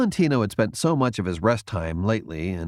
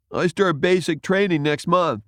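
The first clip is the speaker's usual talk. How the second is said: proud recruit; 8 semitones higher, 3 dB louder than usual. A narrator's reading. Young recruit chimes in.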